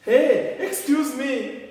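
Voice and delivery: high loud hey, excuse me; calling out. Shown as a demonstration. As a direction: hail someone across the room. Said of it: "Et la personne va dire".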